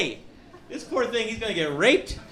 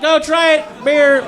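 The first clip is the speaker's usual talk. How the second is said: mock loudspeaker shout; 10 semitones higher, 9 dB louder, slower than usual. Mayor's megaphone announcement.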